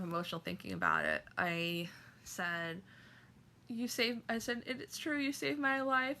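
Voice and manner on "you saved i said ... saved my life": a touch of tearful high voice; crying thanks. Retold or imitated. Fan crying as she thanks Barbara, mildly tearful. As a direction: relive thanking her through tears, mildly. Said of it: "and I'm crying".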